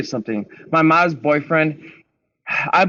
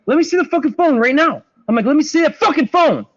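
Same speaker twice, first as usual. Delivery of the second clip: high, loud furious demand; retold angry demand; 11 semitones higher, 4 dB louder than usual. Demanding the boyfriend hand over his phone, angry shout. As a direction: bark the order, raging.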